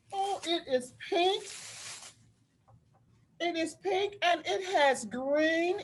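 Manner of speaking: real delight at the colors; delighted surprise. Real, not acted. Teacher discovers quilt colors.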